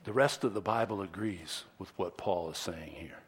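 hushed aside to the congregation; whispered aside. Real, not acted. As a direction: drop to a whisper for this line. Sermon aside, whispered line.

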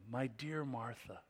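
soft, tender address; compassionate. Acted. Preacher showing the tone of Jesus to Martha, soft compassion. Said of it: "has this tone".

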